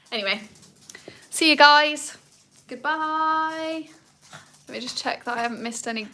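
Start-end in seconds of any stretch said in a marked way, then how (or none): 1.3-3.9 much louder, higher bright goodbye; cheery sign-off. Real, not acted. Host signing off her live stream to viewers, loud cheery goodbye. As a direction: wave everyone off brightly.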